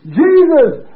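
high, very loud outburst; retold shout. Preacher shouting over a sick man's bed, high retold cry. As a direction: cry it out at full force. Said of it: "And I shouted".

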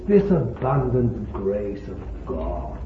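ordinary talk

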